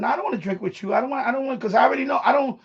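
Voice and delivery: ordinary delivery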